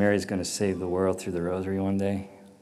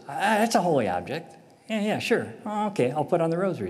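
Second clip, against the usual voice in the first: high, breezy self-mockery; mock dawning realization; 7 semitones higher than usual. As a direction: play your past self catching on. Mocking own past self.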